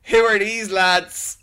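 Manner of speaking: slow, high, loud Dublin lad; laughing Dublin "Who are these lads?"; imitating Barry Keoghan in Dublin accent. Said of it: "he literally says".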